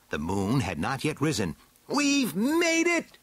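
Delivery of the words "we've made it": very high, drawn-out villain cry; triumphant cry. A narrator's reading. Skeletor to companions on reaching the building, crying out. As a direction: cry it out slowly, way up in pitch. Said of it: "cried Skeletor".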